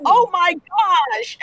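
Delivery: high, drawn-out mock horror; appalled squeal, hammed up; comic dismay. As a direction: wail it slowly, way up high. Horrified at being compared to Bailey.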